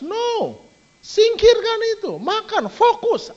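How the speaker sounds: high, loud, firm no-nonsense order; stern command. Retold or imitated. Preacher ordering parents to remove distractions, high and loud. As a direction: bark the command to put it away.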